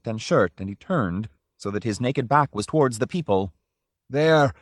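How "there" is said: loud high cry; cried out. A narrator's reading. Drioli showing his back, crying out. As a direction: fling it out triumphant. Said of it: "he cried, breathing quickly".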